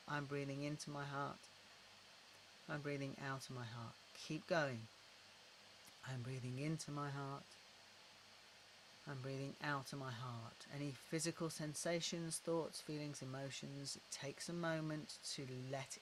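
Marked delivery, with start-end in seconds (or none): 0.1-10.6 unhurried, gentle repetition into the heart; guided breathing. Shown as a demonstration.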